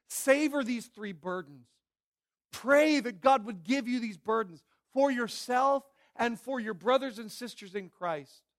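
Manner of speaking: usual speaking voice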